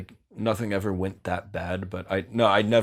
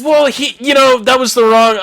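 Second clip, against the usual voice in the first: excited; 13 dB louder than usual. Real, not acted. Laughing, excited retelling.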